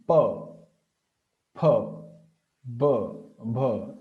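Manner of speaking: each of 'pa, pha, ba, bha' made on the lips, set apart; labial consonants. Shown as a demonstration. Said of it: "the contraction of the lips".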